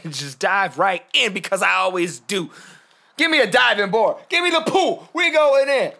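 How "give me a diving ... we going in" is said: high, loud mock-excited holler; hyped shout. Host hyping himself to dive right in, excited shouting. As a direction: whoop it up like diving in.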